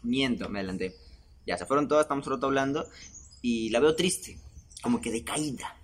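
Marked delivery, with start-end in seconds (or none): 4.8-5.8 lightly imitated halting, trailing intonation; a hint of a pensive TV-host impression; softer, pausing between the words; Jaime Bailey. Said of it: "hice la entonación de Jaime Bailey".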